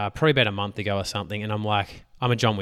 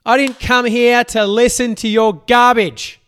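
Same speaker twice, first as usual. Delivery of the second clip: higher, louder impatient rant; mock annoyed heckler, pitched up and loud; fed-up listener; 10 semitones higher, 9 dB louder than usual. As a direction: play a listener who has lost patience with his rambling. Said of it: "Are you like".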